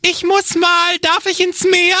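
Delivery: put-on high, pleading kid; child voice. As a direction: beg like a little kid. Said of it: "stellen wir mal so eine typische Geräuschkulisse nach".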